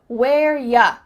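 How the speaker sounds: loud, clear "Where ya"; emphatic "where-yuh"; ya for you. Shown as a demonstration. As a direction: punch "ya" in place of "you". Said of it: "changed it to ya".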